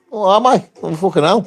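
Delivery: normal talking voice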